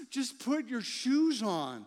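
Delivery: slow, pleading, fed-up parent; exasperated plea. Retold or imitated. Dad begging his kids to get ready, exasperated. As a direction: drag it out like a worn-down dad. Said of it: "so frustrating".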